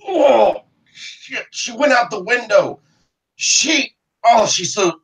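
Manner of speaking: high, whining wounded man; pained marshal. Acted. Wounded marshal telling Russet she fled by window, high. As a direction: moan it out through pain.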